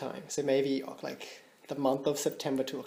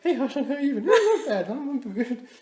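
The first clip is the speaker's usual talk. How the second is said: higher, laughing put-on voices for both sides; imaginary chat voices; 10 semitones higher, 3 dB louder than usual. Talking to herself while pacing, acting both sides in higher voices. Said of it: "I play both sides of the conversation".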